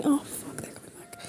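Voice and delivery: real voiceless whisper, very soft; urgent whisper. Real, not acted. Warning co-host that they're coming back, whispered. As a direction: drop to a hushed aside. Breaks off as children approach.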